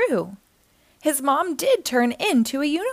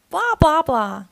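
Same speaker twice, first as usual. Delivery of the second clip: goofy high-then-falling babble; playful nonsense babble; silly blah blah; 4 semitones higher, 5 dB louder than usual. A narrator's reading. Acting silly together.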